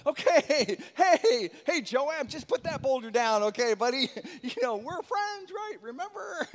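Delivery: high, laughing, pleading voice to an angry crowd; nervous laughing plea to men with boulders; nervous coaxing. Acted. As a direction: coax them to put the rocks down, half laughing. David coaxing men holding boulders.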